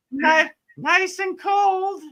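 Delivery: drawn-out, very high tempting sing-song; little voice. Acted. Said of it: "it was like that little voice".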